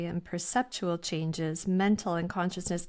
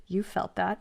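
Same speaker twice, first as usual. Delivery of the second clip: ordinary talk, nothing marked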